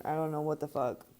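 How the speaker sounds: ordinary talk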